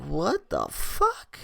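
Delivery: hushed, drawn-out bafflement; quiet, slow-motion disbelief; baffled disbelief. Retold or imitated. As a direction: say it slowly and softly, stunned. Baffled reaction to her squeal.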